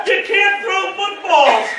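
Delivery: loud, high, slow protest; imitated indignant shout; mock outrage. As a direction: scold in hammed-up outrage. Acted quarrel with Mike.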